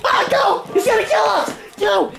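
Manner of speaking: high screams of panic; panicked screaming. Pretend. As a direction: scream and run for your life. Fleeing in pretend terror.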